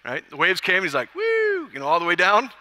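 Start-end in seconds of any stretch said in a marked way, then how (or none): 1.1-1.7 high, held "woo"; gleeful whoop. Acted. Preacher imitating Peter surfing the waves, high gleeful whoop. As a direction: whoop as if riding a wave.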